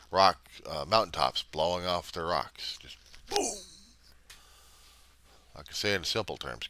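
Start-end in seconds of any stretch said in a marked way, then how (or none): 2.8-3.5 high burst of a cartoon boom; a quick playful boom sound; mock explosion boom. Explosion of volcanic mountaintops.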